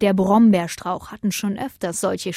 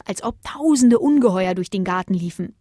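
plain reading voice

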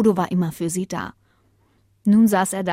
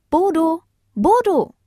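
high, loud call for her husband; raised, high female-character voice; wife calling out; 11 semitones higher, 6 dB louder than usual. Acted. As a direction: call him in from outside. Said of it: "rief Lena, seine Frau".

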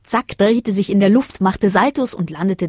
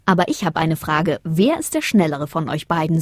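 plain reading voice

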